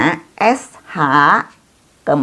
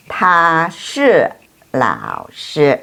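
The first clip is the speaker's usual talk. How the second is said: very slow, syllable by syllable; slow model reading; much slower than usual. Shown as a demonstration. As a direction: stretch each syllable so students can copy. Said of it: "teacher models Chinese answer".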